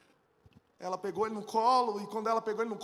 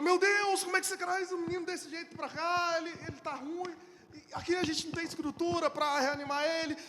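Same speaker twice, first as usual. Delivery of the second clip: a hint of a startled doctor, higher; doctor scolding father for late arrival, higher; alarmed doctor; 6 semitones higher than usual. Doctor voiced in panic.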